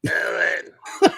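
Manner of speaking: high mock wail of the name; Mama impression. Host reprising the movie mother's call. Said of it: "throw Mama from the train reference".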